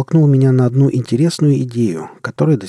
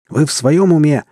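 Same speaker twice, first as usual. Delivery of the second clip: louder, higher, scared yell; frightened yell; 5 semitones higher, 5 dB louder than usual. A narrator's reading. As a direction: shout it in panic. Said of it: "в страхе заорал на пилотов Мюрат".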